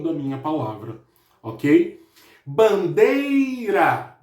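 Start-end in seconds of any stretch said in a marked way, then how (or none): 2.4-4.2 "Bandeira" very slow, DEI stretched long; stress on DEI. Shown as a demonstration. Teacher showing pupils the strongest syllable, long stressed DEI in "Bandeira". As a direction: draw out and lean on the "dei" in "Bandeira". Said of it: "O D é a sílaba mais forte".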